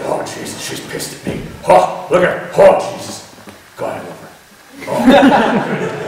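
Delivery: lightly voiced old grandpa, muttering and sighing; Grandpa's voice. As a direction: grumble fondly about your wife, just a hint. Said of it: "I won't continue to do his voice".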